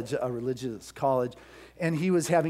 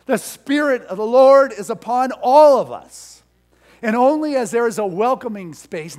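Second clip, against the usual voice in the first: louder, higher fervent sermon; preaching at full force; 8 semitones higher, 10 dB louder than usual. Acted. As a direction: build the pulpit fire. Preaching climax.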